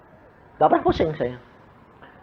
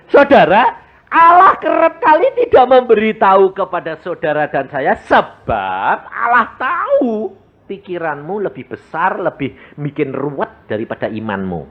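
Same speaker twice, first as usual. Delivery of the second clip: raised preaching voice to the congregation; 5 semitones higher than usual. Acted. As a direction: preach it out to the flock. (suara khotbah)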